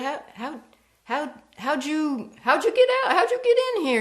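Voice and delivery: stammering, rising to a high, loud terrified cry; panicked disbelief. Acted. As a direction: stutter out, then break into terror. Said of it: "he said".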